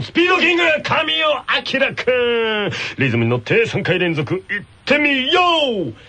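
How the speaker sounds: upbeat announcer hyping the contest; game show host. Acted. Hosting a tongue-twister contest for the radio audience, as an upbeat announcer. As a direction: call it like a TV quiz host over music.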